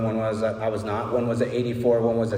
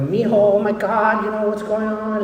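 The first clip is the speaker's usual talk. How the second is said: worried Mexican ladies; 10 semitones higher, 4 dB louder than usual. Retold or imitated. Mexican ladies fussing over a crashed young man, high and worried. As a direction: coo over him like a worried abuela. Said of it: "Mexican ladies downtown were like".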